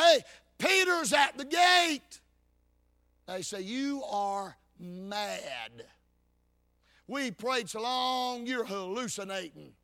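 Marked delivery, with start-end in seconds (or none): 0.0-2.2 excited announcement. Acted. Rhoda announces Peter excitedly. 3.6-9.7 dismissive scoff. Acted. Church scoffs at Rhoda.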